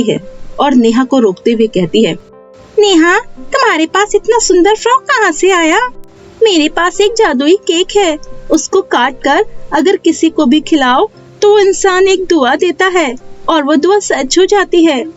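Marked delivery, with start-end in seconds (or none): 2.8-5.9 put-on high-pitched child character voice; inquisitive little girl. Pinky asks Neha about her lovely frock, inquisitive high child voice. 6.4-15.0 put-on high child voice, explaining openly; innocent young girl. Neha tells Pinky about the magic cake, innocent high child voice.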